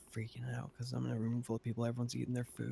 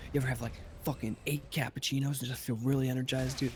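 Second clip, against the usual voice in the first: higher, wired, over-friendly dude voice; hyped-up peppy dude impersonation; energized guy; 4 semitones higher, 3 dB louder than usual. Retold or imitated. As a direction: imitate a guy buzzing with energy. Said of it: "a fucking energy in his eyes".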